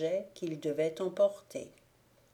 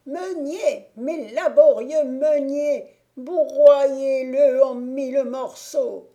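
high, loud shriek, slow at the end; witch screaming; 7 semitones higher, 11 dB louder, slower than usual. A narrator's reading. Witch to the millers, ordering them to crush the prince: high loud shriek. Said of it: "la voix de la sorcière qui leur criait".

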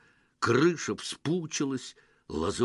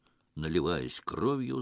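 ordinary delivery, nothing marked

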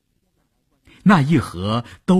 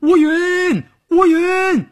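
high, drawn-out calls of a name; urgent shout; 4 dB louder, slower than usual. A narrator's reading. Team leader at the stern after Wuyun dives, urgent high shout. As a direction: call urgently after someone, pitched way up. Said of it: "连忙喊".

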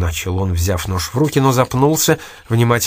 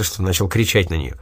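ordinary delivery

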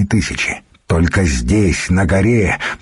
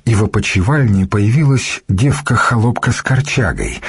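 ordinary delivery

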